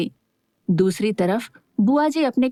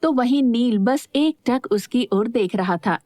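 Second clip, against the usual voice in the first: plain reading voice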